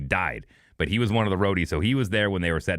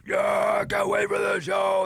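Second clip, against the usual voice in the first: high, drawn-out fan holler; mock excited fans; 12 semitones higher, much slower than usual. Four guys at the bar before the show, excited holler. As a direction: imitate hyped-up concertgoers, voice way up and stretched.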